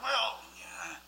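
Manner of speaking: whispered sheepish yeah; whispered admission. Retold or imitated. Admitting to his wife that he sounds angry, whispered. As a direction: concede it in a whisper. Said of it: "I said".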